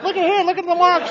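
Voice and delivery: high, excited commiserating voice; laughing fellow drunks. Retold or imitated. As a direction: whoop along in a high, gleeful voice. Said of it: "they'd just laugh at you".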